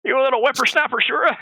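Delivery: hammed-up old man hollering at kids; cranky old man. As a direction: scold the whippersnapper off your lawn. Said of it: "He's like".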